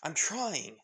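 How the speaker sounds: loud, high irritated snap that settles a little; annoyed loud retort; snapping back. Acted. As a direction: snap at the kid while driving under fire. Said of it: "With Lara saying".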